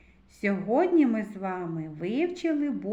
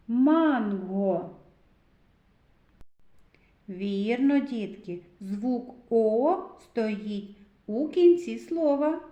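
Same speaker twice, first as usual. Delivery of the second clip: ordinary talk, nothing marked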